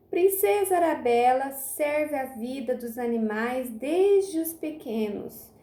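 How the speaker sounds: usual speaking voice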